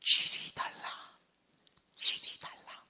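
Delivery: voiceless hushed emphasis; dramatic whisper. Real, not acted. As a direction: whisper the point, then let it hang. Preacher whispers key point for emphasis. (힘주어 속삭임)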